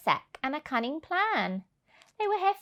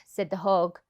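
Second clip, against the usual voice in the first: usual speaking voice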